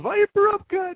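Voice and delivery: very high shouted move name; game move callout. Retold or imitated. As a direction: call the move like a game fighter. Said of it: "he goes".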